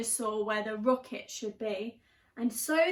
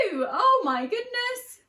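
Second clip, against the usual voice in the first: high, loud, slow shocked cry; 10 semitones higher, 6 dB louder than usual. Real, not acted. Reader reacting to the poo with big shock. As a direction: gasp it out. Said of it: "reader reaction".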